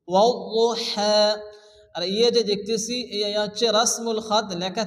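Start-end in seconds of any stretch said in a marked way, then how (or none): 0.1-1.2 ওয়াল্লাহা slowly, final "haa" drawn out; careful tajweed model of ওয়াল্লাহা with long ending; elongated vowel. Shown as a demonstration. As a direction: stretch the last vowel of ওয়াল্লাহা one measure. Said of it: "এগারই পরিমাণ টান দিয়ে পড়তে হবে".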